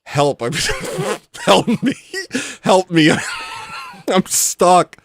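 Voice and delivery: mock dog plea, cracking up; breaking up mid-plea; laughing through quote. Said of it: "what's your opening statement".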